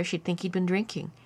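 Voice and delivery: ordinary delivery, nothing marked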